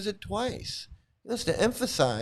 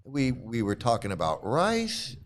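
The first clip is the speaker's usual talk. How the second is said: usual speaking voice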